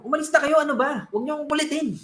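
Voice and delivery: irritated snapping at the rescuers; annoyed. Acted. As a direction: shoo them away, fed up. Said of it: "Nainis siya".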